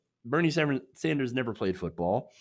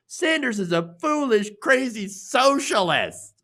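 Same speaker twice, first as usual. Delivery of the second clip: mock commenter; 8 semitones higher, 4 dB louder, slower than usual. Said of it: "More comments".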